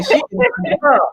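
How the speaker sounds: high, gushing praise; excited. Real, not acted. Host gushing about the artist, excited. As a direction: squeal it with delight.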